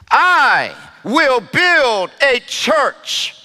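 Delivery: "I" drawn out and set apart, then slow words; stress on I. Shown as a demonstration. Preacher quoting Jesus to the church, stress on "I". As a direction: lean long and firmly on "I". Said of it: "with the emphasis on I".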